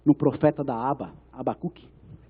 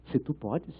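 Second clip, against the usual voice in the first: resposta de Jesus; 4 semitones lower, 13 dB quieter, slower than usual. Retold or imitated. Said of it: "porque ele fala".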